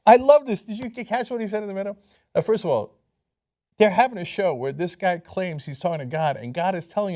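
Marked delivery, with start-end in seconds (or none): none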